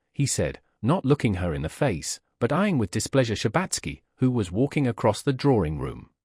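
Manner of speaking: ordinary delivery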